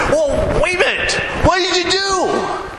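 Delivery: high, shouted, slowing at the end; excited cry of curiosity; eager shouting. Retold or imitated. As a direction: hold on, tell me now. Preacher acting eager for the secret.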